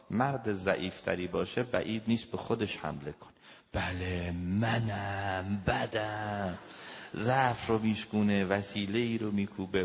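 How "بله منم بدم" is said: drawn-out, mock self-reproach; self-blaming. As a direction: drag out every word as you turn it on yourself. Acting weaker man blaming himself. (کشدار و با سرزنش خود)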